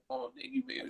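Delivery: put-on high, soft babyish cooing; re-enacted baby voice, small and high. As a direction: coo it like baby talk, high and quiet. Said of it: "talking to her in the baby voice".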